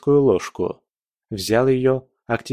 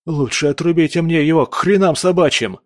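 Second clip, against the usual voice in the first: higher, louder frightened outburst; panicked cry; 6 semitones higher, 4 dB louder than usual. Acted. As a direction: blurt it out in horror. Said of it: "боец в ужасе посмотрел на меня". (испуганный выкрик)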